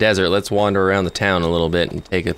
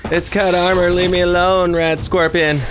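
real panic while fleeing; panicked; 9 semitones higher than usual. Real, not acted. Fleeing armored scorpion in panic.

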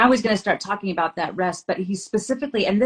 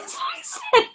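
breaks into laughter while telling it; words squeezed out through laughter; laughing mid-story; slower than usual. Real, not acted. Mom laughing at her daughter's blanket logic.

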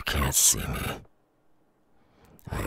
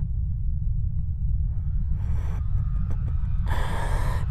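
drawn-out menacing villain cackle; long evil laugh; 3 dB louder than usual. Acted. Demon hears the invasion outside, long evil cackle. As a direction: cackle on and on, relishing the chaos.

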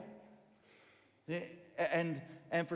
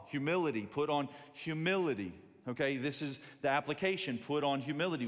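louder, driving sermon cadence; preaching; 5 dB louder than usual. Acted. Pastor urging church to put on humility, loud preaching. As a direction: preach it to the back row.

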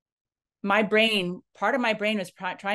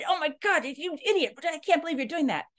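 exasperated outburst; 10 semitones higher than usual. Retold or imitated. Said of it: "and you start going".

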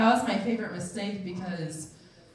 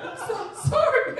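high, squeaky mock sob; hammed-up tearful apology; crying apology. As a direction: imitate bursting into tears. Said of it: "I just start crying".

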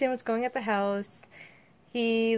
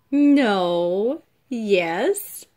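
playful loud no and yes; 8 dB louder than usual. Real, not acted. Mother teasing toddler, loud and playful. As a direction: tease the little one, loud and playful.

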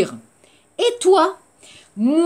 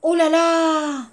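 higher surprised oh là là; repeated oh là là; 5 semitones higher than usual. Shown as a demonstration. Teacher modelling oh là là for repetition. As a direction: exclaim it for them to copy. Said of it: "alors on répète".

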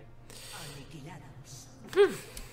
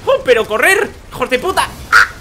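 high, loud furious yell; raging gamer; 9 semitones higher, 8 dB louder than usual. Real, not acted. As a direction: scream at the enemy team, furious. Losing fight, raging.

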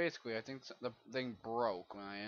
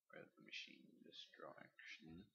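whisper; 5 semitones lower, 16 dB quieter than usual. Real, not acted. Muttering while searching.

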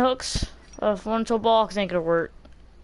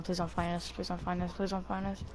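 whispering while hiding; 5 semitones lower, 15 dB quieter than usual. Real, not acted. Hiding from killer, pleading softly.

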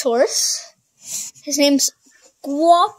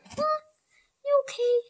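high pet-talk voice; 13 semitones higher, 5 dB quieter, faster than usual. Real, not acted. Talking sweetly to her horse.

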